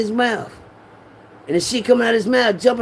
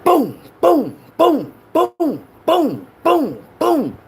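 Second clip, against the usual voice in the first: sharp mimicked gunshots, high and punchy; gunshot sounds; 8 semitones higher, much slower than usual. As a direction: fire off each boom like a pistol shot. Imitating the gunshots.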